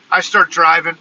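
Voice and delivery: normal talking voice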